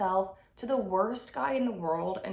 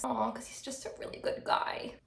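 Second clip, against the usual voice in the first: soft, breathy mock-sympathetic aside; ironiczne rozczulenie; 4 dB quieter than usual. Real, not acted. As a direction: coo it with hushed mock tenderness. Sarcastic fawning over character.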